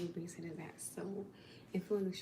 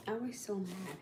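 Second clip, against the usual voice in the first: ordinary delivery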